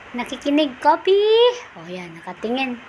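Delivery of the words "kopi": high loud questioning call; calling the dog. Real, not acted. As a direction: sing out his name to catch his ear.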